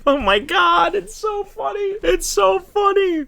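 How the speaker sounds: very high, drawn-out squeal; helpless high-pitched amusement; cracking up. Real, not acted. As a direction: squeal it, overcome with hilarity. Overcome with laughter at story.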